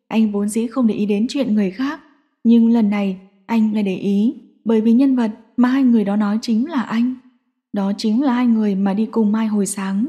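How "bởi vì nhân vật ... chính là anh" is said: plain reading voice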